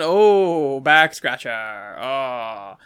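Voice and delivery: slow, drawn-out mock bliss; imitated relief, stretched out; waiter getting scratched. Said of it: "he's going".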